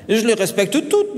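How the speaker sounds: surprised schoolboy, rising at the end. A narrator's reading. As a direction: answer as if taken aback. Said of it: "très surpris".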